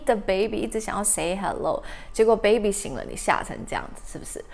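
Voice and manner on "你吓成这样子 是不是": whisper. Acted. Girl comforting the scared puppy.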